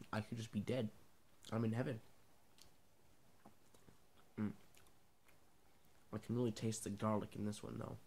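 talking while chewing meat; words muffled by food; mouth full, chewing. Real, not acted. Seen 0: Holds salami slices, bites at +7.6, visibly chewing while talking.

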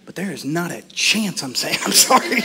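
low, soft stubborn inner voice; stubborn refusal. Acted. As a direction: refuse quietly to yourself.

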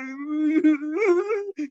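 high burst of laughter. Real, not acted. Preacher laughs at his own whiny Israelites, high laugh. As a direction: laugh at the joke. Laugh amid mock complaint.

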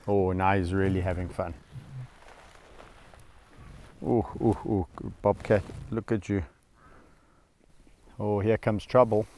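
low, soft murmur to camera; hushed commentary. Real, not acted. Keeper quietly narrating as Bobcat approaches, hushed.

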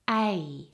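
"A" as a full gliding double vowel. Shown as a demonstration. Teacher corrects a mispronounced vowel, "A" as a double vowel. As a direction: draw out both sounds of the vowel "A". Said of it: "That is a double vowel".

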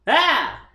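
short, loud, high scream; sudden high yelp into the microphone. Real, not acted. Startled artist finally looking at the drawing, short loud scream. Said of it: "I just screamed into the microphone".

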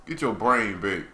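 ordinary talk

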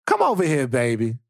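slow, drawn-out auntie coaxing; comforting auntie. Pretend. Auntie calling a wound-up baby over, coaxing. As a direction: stretch the words like a doting auntie. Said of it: "like, an auntie one".

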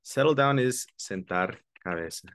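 normal talking voice

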